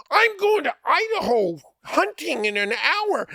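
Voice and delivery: high, loud protest; indignant outburst. Retold or imitated. Friend protesting to the fake officer he's leaving. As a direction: blurt it in disbelief.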